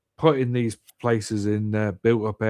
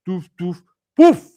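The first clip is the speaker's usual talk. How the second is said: imitated blockage blasting free, rising to a loud pop; mock whooshing noises; 7 semitones higher, 10 dB louder than usual. Telling mate about the drain, imitated bursting noises. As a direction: sound effects for gunk bursting loose.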